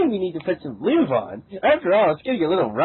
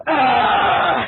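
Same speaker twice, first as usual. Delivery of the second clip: laughing shriek; 9 semitones higher, 6 dB louder than usual. Real, not acted. Squeal amid laughter.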